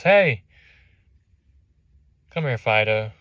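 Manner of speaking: loud call to the dog. Retold or imitated. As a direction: shout for the dog to come back. Owner calling his dog.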